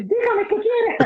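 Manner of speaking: high, laughing through the words. Retold or imitated. Speaker laughing as he retells asking the man. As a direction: crack up as you ask him.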